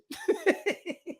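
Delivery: sudden high laugh at own joke; high cackling laugh. Real, not acted. Vlogger cracks up after his own joke. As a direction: crack up at the punchline.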